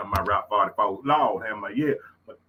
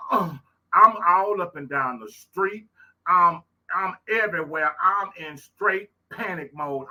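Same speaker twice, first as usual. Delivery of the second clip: panicked retelling; 5 semitones higher, 4 dB louder than usual. Retold or imitated. Retelling panic searching for baby.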